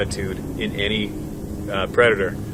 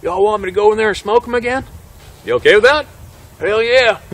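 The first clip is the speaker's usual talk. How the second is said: high, loud, keyed-up hunter voice; pitched-up, urgent impression of the hunter; imitated hunter; 10 semitones higher, 5 dB louder than usual. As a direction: mimic an over-eager hunter in a high voice. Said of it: "hunter turns around and goes like this".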